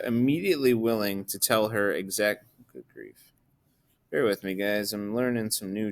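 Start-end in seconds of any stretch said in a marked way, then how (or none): 2.7-3.3 very soft, low mumble; muttered aside. Real, not acted. Teacher to self while struggling with new tools, muttered aside. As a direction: drop right down to a private mutter.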